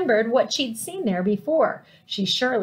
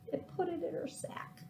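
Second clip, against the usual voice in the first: whispered, higher, hushed; storyteller whisper; 7 semitones higher, 17 dB quieter than usual. A narrator's reading. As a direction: lean in and whisper it to the children. Narrator whispers the climax.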